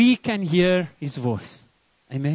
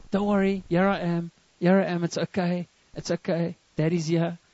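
softer, lower, gently reassuring daddy voice; calm a crying newborn, softly; soothing father; 3 semitones lower, 6 dB quieter than usual. Retold or imitated. New father soothes crying baby.

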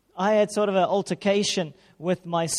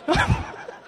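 laughing at himself; squeaky laugh; sudden high laugh; high burst of laughter. Real, not acted.

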